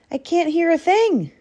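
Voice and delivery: exasperated high complaint; high-pitched complaint. Acted. Hat-muffled Badger complains.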